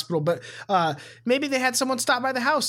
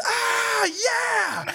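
pitched-up "ah, yeah!" cheer; stoked shout. Retold or imitated. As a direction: whoop it like a hyped fan in the pit. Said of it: "and he goes".

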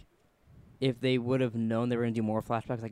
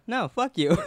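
higher, louder mock snap "No, fuck you."; mock retort; 9 semitones higher, 3 dB louder than usual. Imitating his friend refusing the shot, a mock retort. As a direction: toss off a playful rude refusal. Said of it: "you'd go".

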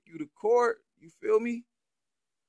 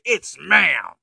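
mimicking; 8 dB louder than usual. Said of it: "steps back to the boy and say".

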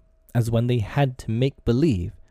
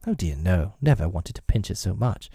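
ordinary delivery

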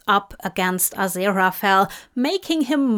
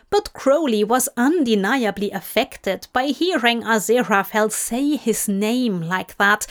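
ordinary delivery

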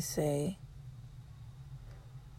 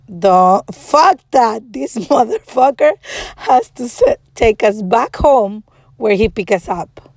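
loud, emphatic angry retelling; retold anger; 2 semitones higher, 4 dB louder than usual. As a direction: act out an indignant rant. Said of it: "I was so angry".

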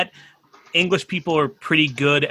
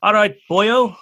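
put-on Welsh gone a bit English; attempted Welsh accent; 3 semitones higher, 4 dB louder, slower than usual. Guest copying host, Welsh accent slipping into English. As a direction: try Welsh, let "boyo" slip towards English. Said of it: "The boyo went a bit English".

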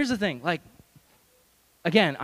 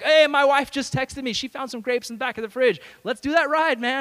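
high, loud fake enthusiasm; overeager cover story; 9 semitones higher, 6 dB louder than usual. Pastor lies to gangster to offer the ride, overeager. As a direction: sell the fib much too brightly. Covers his lie with sudden eagerness.

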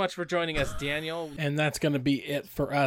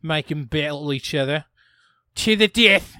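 mock Australian accent; 6 dB louder than usual. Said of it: "I don't know why I'm going Australian".

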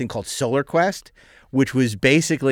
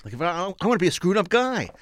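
Shatner voice; 6 semitones higher than usual. Retold or imitated. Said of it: "impersonating Shatner".

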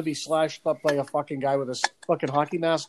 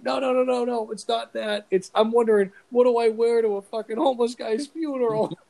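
higher, earnest mock-defensive voice; mock innocent protest; 8 semitones higher than usual. Himself pleading innocently with his angry girlfriend, higher voice. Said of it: "I'm like".

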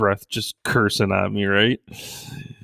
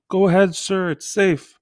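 high, loud call across the road; yelling across; 10 semitones higher, 4 dB louder than usual. Retold or imitated. Yelling across the crosswalk to a blind man, loud high call. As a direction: call out to help a stranger. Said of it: "So I yell at him".

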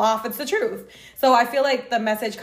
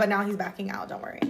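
whisper; 2 semitones lower, 7 dB quieter than usual. Real, not acted. Teasing aside about Don.

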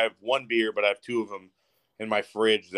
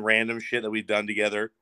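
ordinary talk, nothing marked

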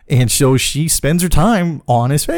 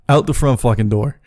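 ordinary delivery

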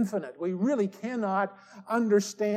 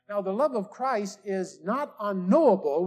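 usual speaking voice

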